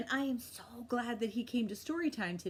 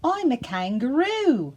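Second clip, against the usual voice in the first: loud, higher answer; kangaroo voice; 5 semitones higher, 11 dB louder than usual. Acted. Kangaroo answering the frog loudly. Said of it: "said Kangaroo".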